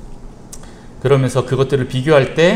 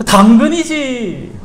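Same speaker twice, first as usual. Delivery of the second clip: mom's punchline; 12 semitones higher, 7 dB louder, much slower than usual. Acted. Mom potato answers.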